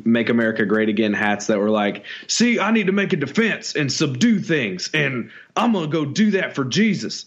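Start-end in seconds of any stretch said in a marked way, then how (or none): 2.3-7.2 exaggerated Southern accent, mocking tone. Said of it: "it gets that accent".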